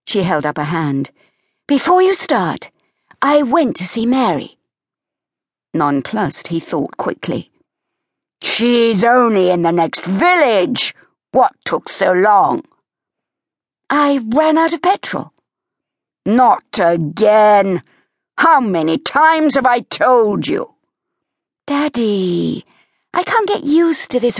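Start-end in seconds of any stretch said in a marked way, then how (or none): 1.7-4.6 put-on much higher young woman's voice, quick defensive; Evie forestalling Daddy. Evie heads off her father. 8.4-20.8 a dynamo of a dad scolding; Leo, exasperated father. Acted. Leo scolds his daughter.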